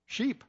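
"sheep" jumps up high in pitch; spring the word "sheep" on them as a joke; comic punch. Acted. Punchline about the shepherd's problem.

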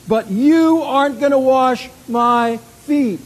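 high, loud protest as an appalled disciple; indignant refusal. Acted. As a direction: refuse loudly, scandalised out of respect. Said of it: "indignation that this is not right".

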